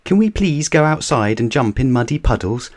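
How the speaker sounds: put-on high, eager child voice; excited. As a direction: ask bursting with excitement. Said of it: "asked Peppa excitedly".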